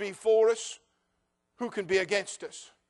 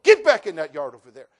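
loud, commanding shout to the dog; yelling at the dog; 5 semitones lower, 11 dB louder than usual. Retold or imitated. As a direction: bark a sharp order at a loose dog. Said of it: "and I told the dog".

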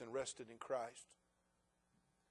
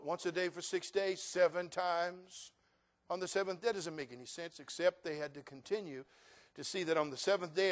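ordinary talk

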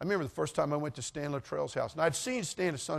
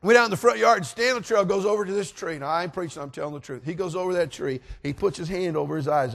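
plain reading voice

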